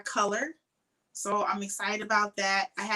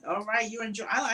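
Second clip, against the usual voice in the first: ordinary delivery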